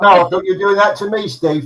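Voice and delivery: ordinary talk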